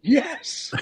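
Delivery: high, emphatic burst of agreement; retold excited yes, pitched right up; eager yes. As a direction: jump on it eagerly. Acted eager yes in story.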